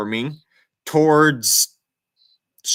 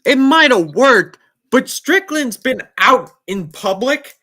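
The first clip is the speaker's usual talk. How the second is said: loud, high, heated insistence; emphatic; 9 semitones higher, 7 dB louder than usual. Real, not acted. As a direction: raise the voice to press the point. Excited argument about a fan.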